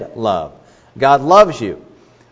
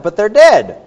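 loud emphatic punch; 7 semitones higher, 9 dB louder than usual. Real, not acted. Preacher's punchline on the dead.

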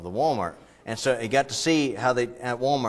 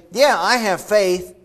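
higher, louder, slow mock claim of faith; smug believer; 8 semitones higher, 7 dB louder, much slower than usual. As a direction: say it like someone sure of themselves. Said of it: "And so you say".

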